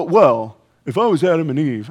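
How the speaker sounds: low, put-on know-it-all objector; mock objector voice. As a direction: mimic a congregant protesting in a low voice. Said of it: "I don't know why you talk like that".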